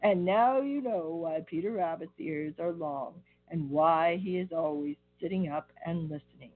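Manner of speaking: plain reading voice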